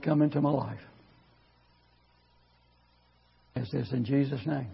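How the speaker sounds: hushed intercession for the congregation; quiet prayer. Acted. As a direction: pray it softly over the room.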